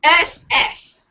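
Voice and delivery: normal talking voice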